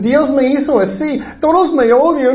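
high-pitched mock lament; put-on self-pitying cry; playing the victim. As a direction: wail it as a mocked victim. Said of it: "ellos actúan como la víctima".

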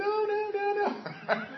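high-pitched mock wailing; put-on tearful pleading, very high; imitated child crying. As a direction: mimic a crying daughter, ending in a laugh. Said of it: "Ella está llorando, diciendo".

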